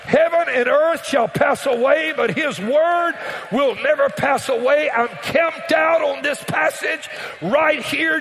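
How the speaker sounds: high, loud, shouted sermon cadence; full-force preaching. Acted. Preacher to congregation, loud full-force preaching. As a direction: preach it out over the music like a revival.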